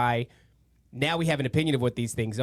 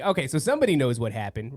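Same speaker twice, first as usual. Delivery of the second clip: ordinary talk, nothing marked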